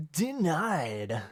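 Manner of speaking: drawn-out, swooping mock verdict; theatrical, teasing denial; mock refusal. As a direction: stretch it out like a playful ruling. Playfully echoing a refusal to wait.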